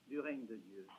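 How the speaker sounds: ordinary talk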